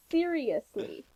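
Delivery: normal talking voice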